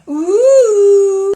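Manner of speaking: long, loud "ooh" of teasing; drawn-out mocking "ooh"; teasing hoot. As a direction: hoot at a classmate's crush. Classmates tease her about a crush.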